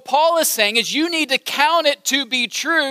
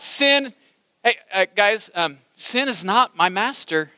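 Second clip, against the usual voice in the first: ordinary talk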